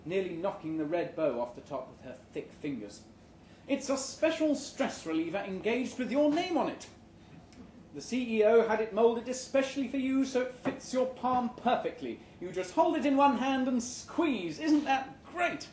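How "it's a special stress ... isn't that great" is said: high, bright-voiced gushing manager; cheery HR boss. A narrator's reading. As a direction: gush like a chirpy HR head. HR head voiced in story.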